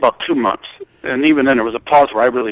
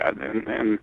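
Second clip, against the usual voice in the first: normal talking voice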